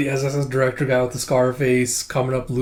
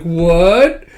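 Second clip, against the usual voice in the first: loud, raised "what"; shocked; 4 semitones higher, 8 dB louder than usual. Retold or imitated. Fiona hears of a marital fight, shocked outburst. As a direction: blurt it in comic shock.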